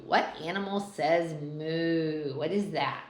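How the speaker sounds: ordinary delivery, nothing marked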